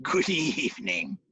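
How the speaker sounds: lightly low, slow beast greeting; a hint of the Pooka's menace; monster voice. Giant horse leaning over to us, low slow menace.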